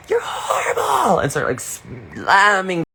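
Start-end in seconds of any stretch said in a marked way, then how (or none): 0.1-1.2 very high, slow, shrieking mock scream; hammed-up squeal like the Superstar character; over-the-top high-pitched dramatic shriek; Mary Catherine Gallagher scream. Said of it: "screaming like Mary Catherine Gallagher".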